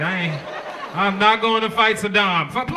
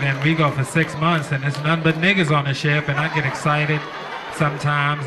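lightly voiced soldier, a touch lower; flirty soldier; 7 semitones lower than usual. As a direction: slide in low and smooth, hitting on someone. Flirting soldier character bit.

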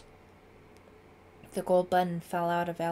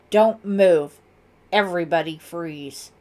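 much louder, commanding shout; ringing shout; 14 dB louder than usual. A narrator's reading. Lieutenant interrupts the suspects, ringing commanding shout. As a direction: bellow an order to halt. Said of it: "another voice rang out".

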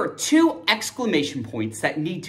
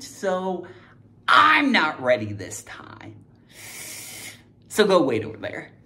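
ordinary talk

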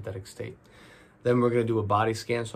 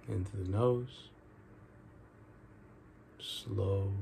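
guided meditation; 7 dB quieter than usual. Real, not acted. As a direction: ease them into each breath, drawing out the key words.

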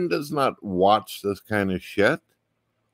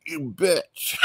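a light, higher-pitched mock retort; mock insulted friend; 8 semitones higher than usual. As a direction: toss it off like an insulted buddy, half joking. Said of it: "You'd be like".

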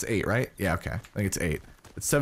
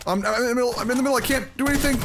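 high, fast panicked cry; 11 semitones higher, much faster than usual. Real, not acted. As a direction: yelp it in a panic. Caught in crossfire, panicking.

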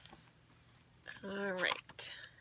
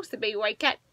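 ordinary delivery, nothing marked

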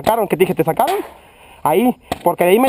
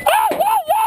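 pained high yelps. Real, not acted. Hit by a paintball.

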